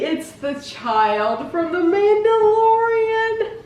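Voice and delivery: high, slow sung-out delight; delighted singsong. Real, not acted. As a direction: sing it out in delight. Toy unboxed, sung out.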